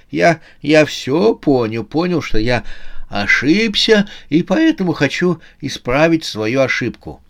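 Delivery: put-on high, halting old man's voice; old man giving in. Old man admits his mistake to Susanna, haltingly.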